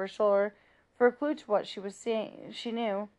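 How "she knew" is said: ordinary delivery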